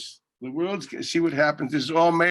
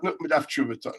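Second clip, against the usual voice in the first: ordinary delivery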